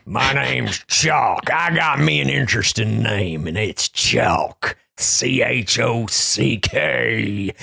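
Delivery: slow, stretched-out drawl; hammed-up contestant introducing himself; Chalk. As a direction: draw out every word in a put-on drawl.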